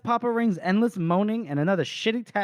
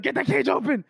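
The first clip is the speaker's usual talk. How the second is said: snapped order; 7 semitones higher than usual. Retold or imitated. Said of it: "he snapped".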